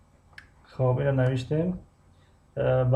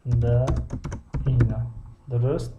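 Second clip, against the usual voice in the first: normal talking voice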